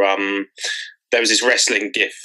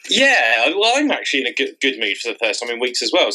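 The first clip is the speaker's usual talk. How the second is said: ordinary talk, nothing marked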